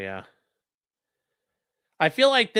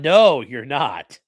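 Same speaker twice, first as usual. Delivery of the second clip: slow retold retort; 3 semitones lower, 9 dB louder, much slower than usual.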